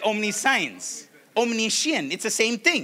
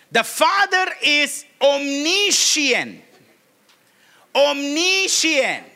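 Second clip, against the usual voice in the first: slow emphasis; 5 semitones higher, much slower than usual. Shown as a demonstration. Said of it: "slow emphatic teaching".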